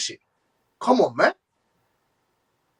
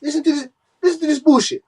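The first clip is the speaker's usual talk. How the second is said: angry; 8 semitones higher than usual. Real, not acted. Furious rant at a receipt.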